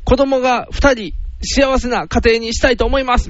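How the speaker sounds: earnest, high-pitched would-be son-in-law; earnest suitor. Acted. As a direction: play the boyfriend declaring himself to her father. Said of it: "お父さん".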